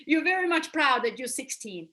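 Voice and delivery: laughing while talking. Real, not acted. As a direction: chuckle through the words. Laughing at forgetting own age.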